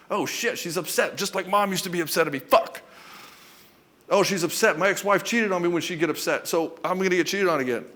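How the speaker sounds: put-on a hurt man spiralling into old fears; panicked inner voice. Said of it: "all his trauma ... starts to come up".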